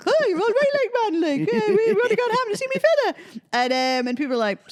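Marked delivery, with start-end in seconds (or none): 0.1-3.1 high, excited broad Northumberland rush. Retold or imitated. Host showing her unhinged on-stage Northumberland accent. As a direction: let the Northumberland accent burst out with joy. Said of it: "my accent comes out".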